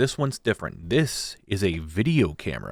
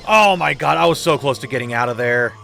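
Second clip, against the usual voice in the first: exasperated; 2 semitones higher, 6 dB louder than usual. Real, not acted. Nearly escaping the Rumbler.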